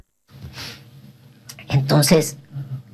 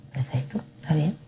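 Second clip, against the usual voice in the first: unvoiced calm reply; whisper; 8 dB quieter than usual. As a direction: answer softly and calmly. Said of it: "mi esposo responde". (susurro tranquilo del esposo)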